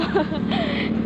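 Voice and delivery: breathy laugh mid-sprint; laughing while running. Real, not acted. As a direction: burst out laughing as you take off. Laughs while starting to run. (tawang habang tumatakbo)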